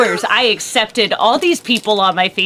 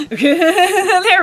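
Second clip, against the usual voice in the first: laughing; 6 semitones higher than usual. Real, not acted. Laughing at own joke.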